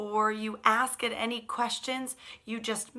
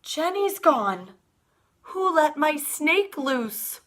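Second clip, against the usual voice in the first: high, slow, louder cry of alarm; alarmed shout; 6 semitones higher, 6 dB louder, slower than usual. Acted. Emily shouts to the class her snake escaped, alarmed. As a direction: call out in a panic. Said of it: "Emily shouts".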